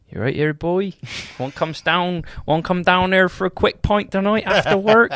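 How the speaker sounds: broad Somerset farmer-style accent. Shown as a demonstration. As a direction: invite a pal in broad Somerset.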